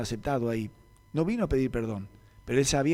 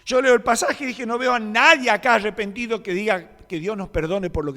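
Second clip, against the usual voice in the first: much higher, louder impassioned sermon; fiery preaching; 10 semitones higher, 8 dB louder than usual. Acted. Pastor exhorts the congregation to forgive, in fiery preaching. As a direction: preach it with full force.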